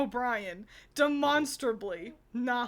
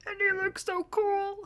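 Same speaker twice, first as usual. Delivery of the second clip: high, put-on character voice; Doug voice; 9 semitones higher, 4 dB louder than usual. Shown as a demonstration. Demonstrating the voice improvised in a panic, high goofy character voice. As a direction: put on a higher, uncool voice for Doug. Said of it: "Doug sounds like this".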